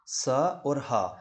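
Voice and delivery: स and ह drawn out slowly; breathy fricative demo. Shown as a demonstration. Teacher showing hot-air consonants. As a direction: let the air hiss out on स और ह. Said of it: "इसमें गर्म हवा बाहर निकलती है".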